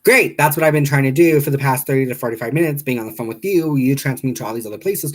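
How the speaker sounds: loud, fast re-enacted outburst; imitated fed-up caller; retold anger. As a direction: burst in high, then rant quickly. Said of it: "I got pissed off".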